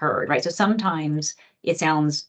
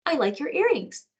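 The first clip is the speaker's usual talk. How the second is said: put-on higher little-girl voice; 9 semitones higher than usual. Girl admiring the adult's earrings, in a little-girl voice. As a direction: compliment her like a small child. Voicing the four-year-old girl.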